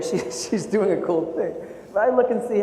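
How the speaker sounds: usual speaking voice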